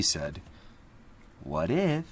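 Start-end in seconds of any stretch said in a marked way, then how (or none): 1.4-2.1 higher, hopeful what if; Leo asks. A narrator's reading. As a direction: offer the question gently but clearly. Spoke two simple words.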